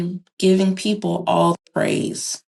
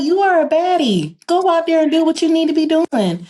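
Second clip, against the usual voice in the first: hype-up; 9 semitones higher than usual. Retold or imitated. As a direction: pump her up to go back out. Retells hyping up her friend.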